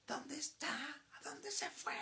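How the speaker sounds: high panicked screaming, rising to a breathless cry; frantic scream. Retold or imitated. Mother searching for her vanished child, frantic scream. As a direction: shriek it like a mother losing her mind. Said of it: "empezó a gritar como loca".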